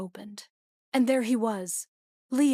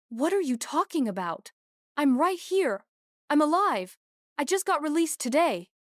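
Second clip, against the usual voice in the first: desperate plea; 7 semitones higher, 4 dB louder than usual. A narrator's reading. Desperate protest of being alive.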